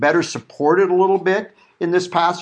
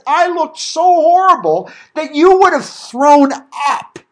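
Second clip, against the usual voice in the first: dramatic [name] quote; 9 semitones higher, 6 dB louder, slower than usual. Retold or imitated. [name] retelling his battered look.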